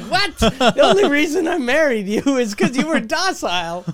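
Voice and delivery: mock wife outburst. Friend imagines the wife snapping at him, mock outraged and laughing. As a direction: ham up an indignant wife, laughing.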